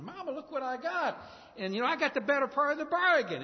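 put-on high, eager child's voice, the last line rushed; lightly voiced proud schoolboy; boastful little kid. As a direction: play a kid bragging about his trade to mama. Child boasting to his mother.

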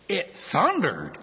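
slow, incredulous echo of a silly answer; amused disbelief. Acted. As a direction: repeat it as if you can't believe it. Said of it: "I kinda giggle at that and say".

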